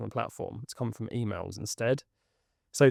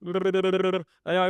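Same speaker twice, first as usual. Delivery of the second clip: laughing; 5 semitones higher than usual. Real, not acted. Laughing right after a laugh.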